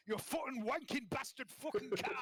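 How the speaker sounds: slow, high Sunderland swearing; hammed-up Sunderland granddad cursing his car; Sunderland accent. Shown as a demonstration. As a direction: curse in a drawn-out, high-pitched Sunderland accent. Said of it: "Sunderland. I can do that by going".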